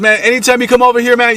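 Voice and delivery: high, fast, insistent neighbour voice; imitated gunman. Gunman offering boyfriend his yard, high fast imitation. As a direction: rattle it off high as the protective stranger.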